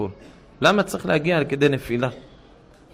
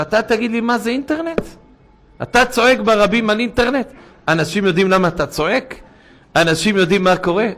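indignant outburst; 9 semitones higher, 4 dB louder than usual. Retold or imitated. Driver's angry outburst retold.

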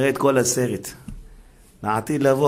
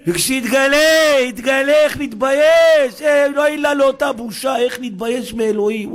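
high, loud preaching cry of shame; impassioned cry; 12 semitones higher, 6 dB louder than usual. Acted. Preacher cries out about shame. (קריאה רמה ונרגשת של דרשן)

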